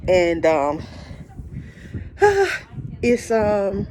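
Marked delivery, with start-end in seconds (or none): 0.9-3.0 long emotional sigh; heavy sigh. Real, not acted. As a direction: let out a long breath before going on. Moved by a sad film.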